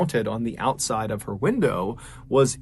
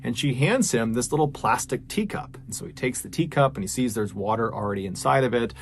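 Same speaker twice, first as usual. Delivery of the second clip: ordinary delivery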